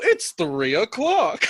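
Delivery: slow, laughing time check; slow mock announcement. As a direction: announce the time slowly and grandly. Acting out telling the time.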